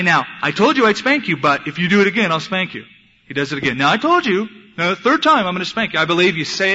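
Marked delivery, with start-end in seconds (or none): 0.0-2.9 higher, louder mock scolding; nagging parent's threat. Mimics parent's empty repeated threats. 3.8-6.0 high, loud mock scolding easing at the end; escalating parental threat. Mimics parent's empty repeated threats.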